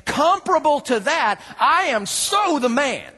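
high, loud, slow smug boast; gloating older brother, slowed and laughing; smug self-righteous. Acted. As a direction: crow it like Mister Self-Righteous. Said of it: "Mister I think I'm perfect".

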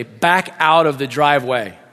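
child's firm driving instruction, acted; giving directions. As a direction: give each word of the order clearly. Boy gives directions, dad echoes.

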